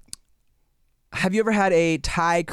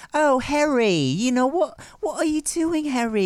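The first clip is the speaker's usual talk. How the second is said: high-pitched posh Harry Potter English; posh British accent; 11 semitones higher than usual. Shown as a demonstration. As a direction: fuss over a pupil in a hammed-up posh British accent. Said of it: "posh accent".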